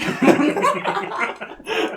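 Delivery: squealing high laugh; real high laugh; high burst of laughter. Real, not acted. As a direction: break into a high cackle. Laughs at the joke.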